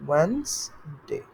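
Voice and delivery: "Wednesday" as a slow "wens... day"; English pronunciation. Shown as a demonstration. Teacher sounds out the spelling, "Wednesday" slowly as "wenz-day". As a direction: stretch "Wednesday" into two parts, "wenz-day". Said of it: "W E N S".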